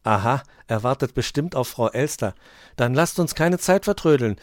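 put-on a keen young hare hurrying friends; eager child. A narrator's reading. Hoppel urges friends to the telescope, eager and loud. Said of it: "sagte Hoppel".

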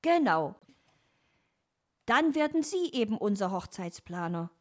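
a touch of cheerful excitement; beaming. A narrator's reading. Dominik offers fox the planner job, mildly beaming. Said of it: "strahlte Dominik".

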